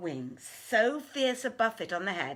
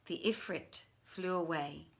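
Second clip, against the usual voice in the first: ordinary delivery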